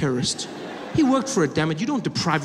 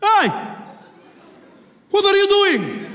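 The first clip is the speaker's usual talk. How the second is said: put-on high, loud Middle Eastern-accented challenge; challenging shout; 7 dB louder, slower than usual. Passenger opposite challenges the bomber, shouting in accent. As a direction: call him out loudly, acting indignant. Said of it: "some guy will be opposite him".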